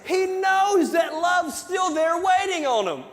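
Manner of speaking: preaching at full force. Acted. Pastor retelling the prodigal son to his church, shouting with fervor. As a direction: preach it big, like you're lifting the whole room.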